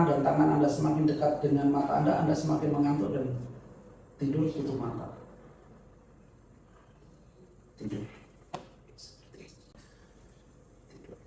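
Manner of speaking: hypnotic command. Acted. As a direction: lull them into sleep. Hypnotist puts subject to sleep.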